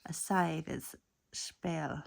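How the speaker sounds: normal talking voice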